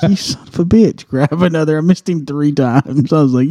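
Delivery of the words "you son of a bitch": exasperated curse. Retold or imitated. Third miss, exasperated.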